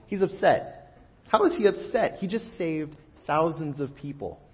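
high, baffled disbelief; can't believe he's upset, voice shooting up; incredulous. Real, not acted. Baffled preacher over Jonah's anger.

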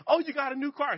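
high, excited "oh, you got a new car"; happy surprise. Retold or imitated. Father thanking his son for the new car, happy and high. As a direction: pitch it way up like a thrilled dad. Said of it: "He was like".

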